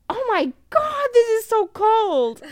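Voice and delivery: high, loud mock cry of shock at the cold; 과장된 경악. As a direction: exclaim in exaggerated shock, drawing out the last words. Acts out shock at icy water.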